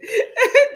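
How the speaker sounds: high, short burst of laughter; giggle. Real, not acted. Co-host amused by her own emphasis, high giggle.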